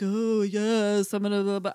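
lightly put-on medium's voice; a hint of a fake séance voice. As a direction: put on a phony mystic voice. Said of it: "like, this fake voice".

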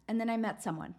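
normal talking voice

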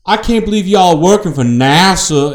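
high, loud mock southern accent; Kevin Costner. Host imitating a film actor, mock southern accent. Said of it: "he has kind of a southern accent".

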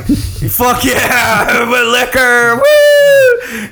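cheering for a night of drinking; excited cheer. Retold or imitated. To friends, cheering about loving booze, hyped whoop. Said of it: "So I was like".

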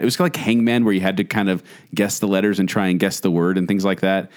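normal talking voice

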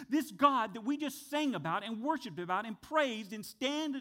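usual speaking voice

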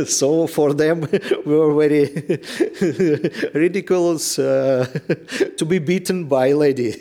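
laughing through the words; amused, laughing out loud. Real, not acted. Laughing at men beaten by lady.

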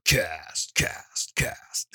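word repeated, dying to a whisper; fading eerie echo. Acted. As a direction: let it echo away into a breathy hush. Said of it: "Now I'm creeped out".

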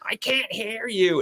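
high, slow, whiny mock call from the crowd; mock heckler call. A heckler in the audience calls out to the comedian, high and drawn out. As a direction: stretch it out like a clueless heckler shouting to the stage. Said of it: "this bitch in front of us just goes".

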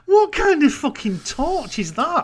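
incredulous outburst; outraged. Real, not acted. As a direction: blurt it out in disbelief.

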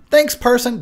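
high, loud mock gratitude; sarcastic thanks. Real, not acted. Vlogger sarcastically thanking the noisy neighbor, loud mock gratitude. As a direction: throw fake thanks out the window.

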